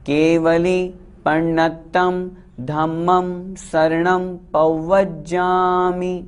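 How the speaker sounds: केवली पण्णत्तं धम्मं शरणं पौवज्जामि chanted slowly, every syllable clear; slow chant, doubled consonants held; correct pronunciation. Shown as a demonstration. Teacher repeats the last line slowly so students say it purely. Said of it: "अंतिम line शुद्ध उच्चारण हो".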